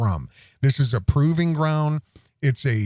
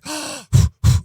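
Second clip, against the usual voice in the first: high, loud delighted gasp; toddler gasp; 13 semitones higher, 6 dB louder than usual. Retold or imitated. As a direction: gasp like a toddler seeing the flag. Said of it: "she's like".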